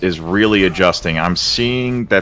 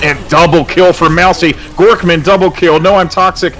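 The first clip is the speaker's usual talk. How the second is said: excited shout; 9 semitones higher, 4 dB louder than usual. Real, not acted. Team fight, hype play-by-play.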